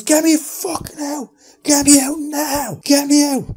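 desperate, high, full-force scream. Retold or imitated. Man trapped inside Barney to his helpers, screaming. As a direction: scream to be let out, stretching the words. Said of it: "just screaming".

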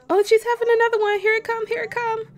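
high and fast; rushed excitement. Real, not acted. Gamer calling out as the second baby arrives, fast and high. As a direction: rattle it off as it happens.

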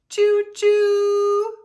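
loud, high choo-choo whistle; train imitation. Pigeon pretending to drive a train, loud, high choo-choo.

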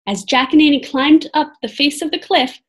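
ordinary delivery, nothing marked

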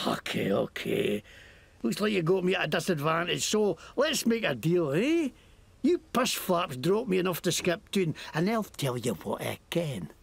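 lightly Scottish mocking thug; Scottish accent. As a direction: sneer at them in Scots. Scottish NPC bargains.

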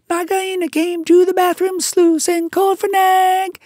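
very high, loud chirpy bird voice; a shrill little bird, bursting with triumph; tailorbird. A narrator's reading. Bird tells the mongoose the news, triumphant. Darzee speaking.

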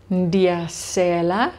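Ndiyasela said slowly, every syllable clear; teacher modelling Ndiyasela slowly; slow model pronunciation. Shown as a demonstration. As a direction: carefully sound out Ndiyasela for the class. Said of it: "I am drinking".

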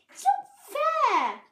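high, loud whine; indignant complaint. A narrator's reading. Babbitty envies the trick, high indignant whine. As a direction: wail it like a sulky child. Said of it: "Babbitty thought".

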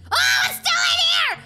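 very high, loud shout of terror; loud horrified wail; panicked scream. Acted. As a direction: shriek that the monster is back. Monster found inside again.

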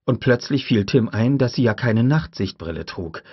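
ordinary delivery

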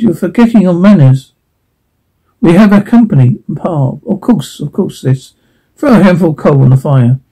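ordinary talk

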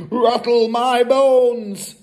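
high, slow ghostly wail; spooky parrot. A narrator's reading. Hidden parrot haunts the family, spooky wail. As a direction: stretch it out eerily, loud. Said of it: "soft and spooky this time".

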